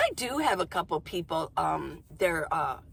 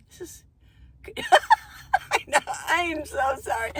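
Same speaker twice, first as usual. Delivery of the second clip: high-pitched apology through laughter; laughing apology; 9 semitones higher, 4 dB louder than usual. Real, not acted. Vlogger apologising to viewers for herself, laughing. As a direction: break up laughing while apologising.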